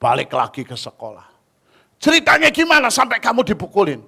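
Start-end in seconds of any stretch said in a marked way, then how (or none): 2.0-4.0 loud, high and fast angry question; upset demand. Retold or imitated. Teacher angrily asks pupil how he got beaten. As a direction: press the pupil hard, upset. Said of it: "Bapak itu kesel, marah banget".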